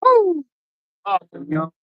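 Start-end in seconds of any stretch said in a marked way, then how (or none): 0.0-0.5 high, loud cheer; joyful whoop. Real, not acted. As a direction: let out a happy woo. Cheer over first catch.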